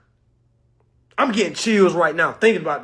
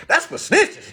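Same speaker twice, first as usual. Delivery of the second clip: higher, louder, knowing; 10 semitones higher, 5 dB louder, slower than usual. Retold or imitated. Telling viewers plainly about snitches, loud. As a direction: announce it with a grin.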